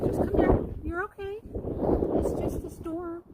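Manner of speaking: high, tender reassurance to a nervous dog; high-pitched, coddling pet voice; soothing dog talk. Real, not acted. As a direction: comfort a scared pet in a high, gentle voice. Comforting dog scared of storm.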